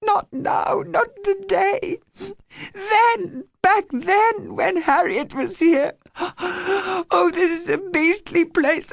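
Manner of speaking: high, tearful, slow wail; girl crying that she wants to go home. A narrator's reading. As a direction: sob it out, begging to leave. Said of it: "she wailed".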